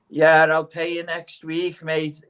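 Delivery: debtor's excuse. Retold or imitated.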